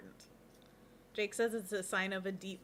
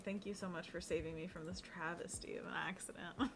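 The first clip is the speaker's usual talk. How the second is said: whispered thanks; a whispered, grateful aside; 3 semitones lower, 7 dB quieter than usual. Real, not acted. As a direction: breathe it out with no voice. Whispered thanks after mishap.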